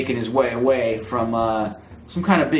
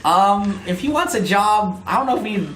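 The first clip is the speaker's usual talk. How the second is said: squeaky gorilla voice; 5 semitones higher, faster than usual. Acted. Katie Kong voiced on phone.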